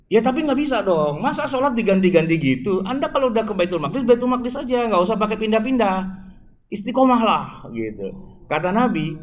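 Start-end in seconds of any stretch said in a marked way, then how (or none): 0.1-7.5 high, pressing argument, fast at first; heated objection. Acted. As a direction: push back hard, voice raised high.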